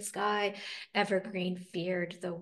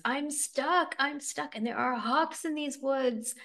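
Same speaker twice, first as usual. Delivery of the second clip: high-pitched worried plea; acted distress of a stuck bunny; trapped rabbit pleading; 6 semitones higher, slower than usual.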